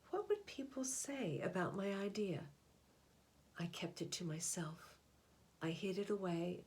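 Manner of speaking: put-on gentle storybook narration for children; picture book reading. A narrator's reading. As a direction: read it like a bedtime picture book. Reads picture book aloud.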